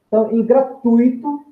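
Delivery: ordinary talk, nothing marked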